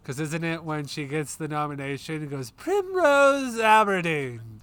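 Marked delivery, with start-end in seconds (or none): 2.5-4.6 announcing a name. Retold or imitated. Quoting the nomination call.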